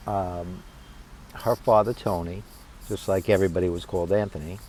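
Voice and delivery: ordinary delivery, nothing marked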